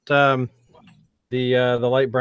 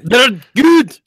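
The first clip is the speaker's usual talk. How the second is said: loud, high Scottish engineer; Scotty; 11 semitones higher, 9 dB louder than usual. Acted. Engineer reporting on the shields, loud high Scottish accent. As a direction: cry out a mock-Scottish status report.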